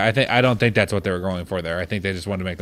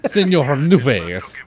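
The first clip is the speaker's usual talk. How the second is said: mock Italian accent; 6 semitones higher, 6 dB louder, slower than usual. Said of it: "did the Italian accent wear it on you".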